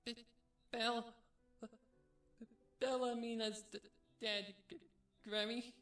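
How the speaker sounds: loud, high, stuttering filly sobs; choked sobbing. A narrator's reading. Filly tells grandmother of death, loud choked stammer. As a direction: choke on each word, pitched high. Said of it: "Pinkamena choked a little".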